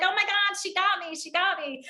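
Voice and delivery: high, rushed mock alarm at being caught out; mock dismay. Presenter voicing a caught-out audience member, in playful dismay. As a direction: play the listener who has just been found out.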